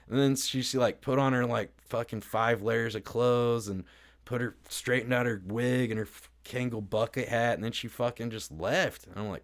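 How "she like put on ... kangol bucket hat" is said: ordinary delivery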